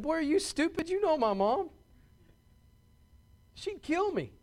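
high-pitched, incredulous comeback to a buddy; incredulous retort. Retold or imitated. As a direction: retell it as a high, disbelieving protest. Retelling his reply to a buddy.

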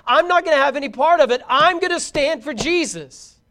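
impassioned preaching. Real, not acted. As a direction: build a driving list of resolutions. Preaches a call to decide.

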